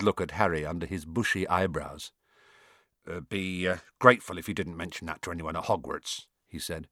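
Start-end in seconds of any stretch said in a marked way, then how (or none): 3.1-6.3 low, hesitant gamekeeper voice; sheepish Hagrid. A narrator's reading. Hagrid asking Harry to keep quiet, sheepishly. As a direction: ask awkwardly, low and halting.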